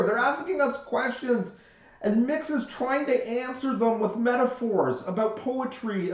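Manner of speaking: high, loud and hurried bandmate; excited bandmate voice. Retold or imitated. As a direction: push it out high and urgent. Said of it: "She's like".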